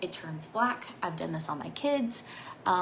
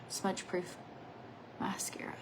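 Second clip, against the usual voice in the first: whisper; 8 dB quieter than usual. Real, not acted. Whispered aside while applying makeup.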